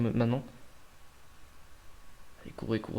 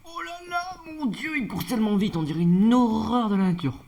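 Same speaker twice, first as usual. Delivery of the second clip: much higher, breathless exclamations of shock; alarmed excitement; 10 semitones higher, 3 dB louder than usual. Real, not acted. Player watching a monster sprint at him, shocked cry. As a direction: react to something terrifyingly fast. Monster rushes at his kingdom.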